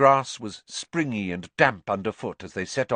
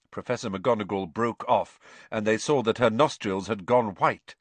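plain reading voice

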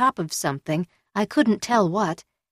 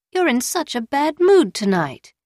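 teasing woman voice; 7 semitones higher, 4 dB louder than usual. A narrator's reading. Hatsumomo teasing angry boyfriend.

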